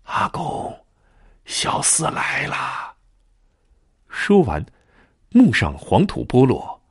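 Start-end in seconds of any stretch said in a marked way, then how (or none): 0.0-2.9 soft, very slow ghostly answer from the grave; distant hollow echo, drawn out and soft; faint lingering echo. Acted. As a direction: breathe it out like a voice from under the earth. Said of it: "一声悠悠的回音".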